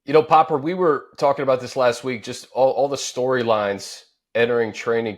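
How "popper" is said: ordinary delivery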